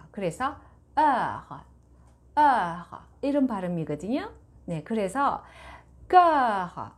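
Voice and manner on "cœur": "cœur" with rounded lips and an open e-like vowel, stressed; French vowel demo. Shown as a demonstration. Teacher models the vowel in cœur. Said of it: "이렇게 발음을 하는 거예요".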